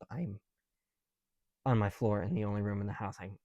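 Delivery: ordinary delivery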